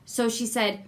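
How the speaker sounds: usual speaking voice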